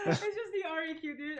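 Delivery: laughing high while talking. Real, not acted. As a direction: break into a laugh mid-line. Laughs at a teammate's death.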